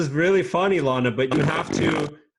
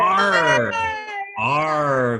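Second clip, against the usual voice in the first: pirate arr; 3 dB louder than usual. Shown as a demonstration. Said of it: "What sound does a pirate make".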